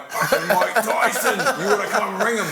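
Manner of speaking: loud, high starstruck laughing; excited mate. Retold or imitated. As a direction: imitate a thrilled friend breaking big news. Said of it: "he rings my phone, he goes".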